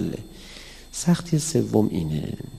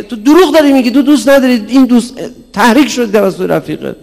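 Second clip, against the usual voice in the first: loud, high accusing shout; scolding shout; 12 semitones higher, 13 dB louder than usual. Retold or imitated. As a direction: call out the lie. Scolding child for lying.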